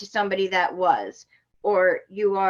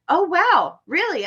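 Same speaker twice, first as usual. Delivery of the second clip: high, louder mock-impressed retort; mock amazement; 9 semitones higher, 5 dB louder, slower than usual. Driver answering officer's routine-stop excuse, mock amazed. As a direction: feign wonder with swooping pitch.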